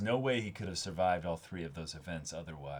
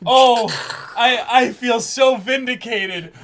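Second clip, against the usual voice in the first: high, loud and slow with laughter; gleeful shouting of triumph; ecstatic yelling; 19 dB louder, slower than usual. Real, not acted. Proud of roasting the villain, yelling in laughing triumph. Overjoyed over being proven right.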